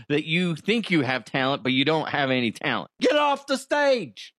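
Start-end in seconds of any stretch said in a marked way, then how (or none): 3.0-4.3 high, drawn-out heckle; mock jeering shout; heckler's yell. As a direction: heckle a bad act off the stage. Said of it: "what would that sound like if it's Irish".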